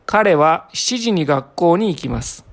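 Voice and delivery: ordinary talk, nothing marked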